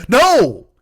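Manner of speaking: high, loud yelled NO; re-enacted outburst. Diner replaying his answer to the waiter, explosive shout. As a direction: fire the refusal out at full volume.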